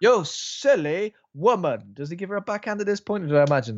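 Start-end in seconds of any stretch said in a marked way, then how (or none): 0.0-1.8 slow, higher, hammed-up snooty put-down; mock pompous Harker. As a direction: stretch it out like a condescending gent. Mocking Harker's line back.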